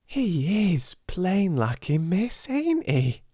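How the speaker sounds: high, slow cockney maid; a servant girl in awe; high admiring young maid; Becky's voice. Acted.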